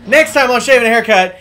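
big booming show-closer announcement; loud announcer outro. Acted. Restarts outro, much louder.

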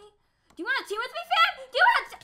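very high, loud plea; frantic yelling. Real, not acted. Gamer begging an opponent to team up, frantic yell. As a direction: shout the offer in a rising panic. Repeats team offer in panic.

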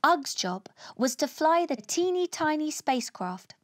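usual speaking voice

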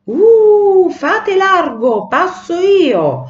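high, loud howl, drawn out slowly; furious shouting. A narrator's reading. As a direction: bellow like a raging wind clearing its path. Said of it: "furioso gridava". (grido furioso, acuto e prolungato)

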